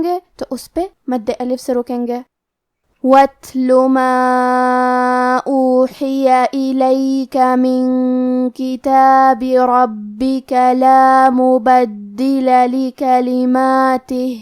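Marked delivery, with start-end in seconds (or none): 3.0-14.3 slow chanted tajweed recitation; slow murattal chant; Quran recitation. Shown as a demonstration. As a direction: recite melodically, stretching the long vowels.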